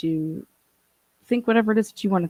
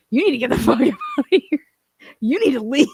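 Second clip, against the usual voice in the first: imitating the dad; 7 semitones higher, faster than usual. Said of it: "He's like".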